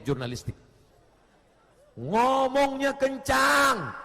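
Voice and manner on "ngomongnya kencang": slow, higher, loud shout; loud talk demo. Shown as a demonstration. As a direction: show how a loudmouth speaks. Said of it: "kita ngomong pelan". (contoh bicara kencang, lambat dan tinggi)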